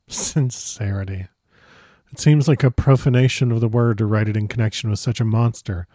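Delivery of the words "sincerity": low, slow, soft scornful repeat of "Sincerity."; scornful echo. A narrator's reading. Narrator sneering at the Count's "Sincerity.". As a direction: sneer out "Sincerity." quietly and slowly.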